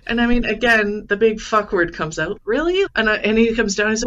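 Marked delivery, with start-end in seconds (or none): 2.4-2.9 "Really?" jumps much higher, loud and incredulous; mock outrage at bad luck; exasperated disbelief. Retelling her reaction to water dripping on the laptop, high and incredulous. Water pouring onto the podium.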